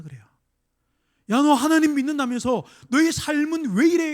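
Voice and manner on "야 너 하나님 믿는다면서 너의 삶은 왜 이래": louder and higher, sneering at the believer; loud mocking taunt. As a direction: jeer it out loud like a scoffer. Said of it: "이러는 거".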